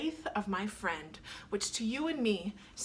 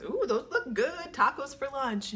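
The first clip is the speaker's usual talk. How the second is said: higher, bright mock-gush about tacos with a laugh; a laughing, higher swoon over lunch; playful gushing; 5 semitones higher, 3 dB louder than usual. Retelling her own playful Instagram comment.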